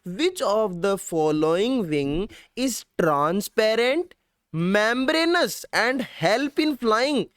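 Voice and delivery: very slow, drawn-out exam question; reading out the exam question slowly. Shown as a demonstration. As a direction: read the question word by word. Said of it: "आपसे question पूछेगा".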